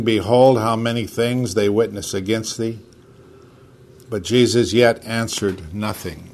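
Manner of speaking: solemn King James reading; scripture reading. Acted. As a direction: read the passage aloud to the group. Reads Mark fifteen aloud.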